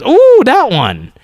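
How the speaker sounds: put-on high, slow, drawn-out little-kid ooh; delighted toddler. Dad imitating his toddler spotting ice cream, high and delighted. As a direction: stretch it out like a thrilled two-year-old.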